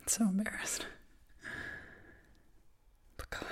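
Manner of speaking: soft choked whisper. Acted. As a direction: barely voice it. Eulogy, overcome.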